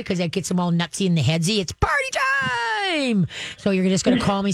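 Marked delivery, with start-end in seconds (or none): 1.6-3.3 very high, slow, shouted party squeal; party-time squeal. Retold or imitated. Trainer mimics overexcited dog, very high shouted squeal. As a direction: squeal it like the dog going wild. Dog excited by good boy.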